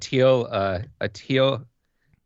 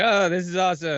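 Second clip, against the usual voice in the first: high, excited mock fan gushing; 9 semitones higher than usual. Friend mimics fans gushing over a crab jersey, high and excited. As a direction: gush like a delighted fan, pitched way up.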